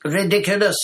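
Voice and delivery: high, loud shouted spell. A narrator's reading. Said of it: "shouted Seamus".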